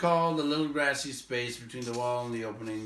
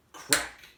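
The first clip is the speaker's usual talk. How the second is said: sharp crack; 6 dB louder than usual. Acted. Thrush cracks snail on stone.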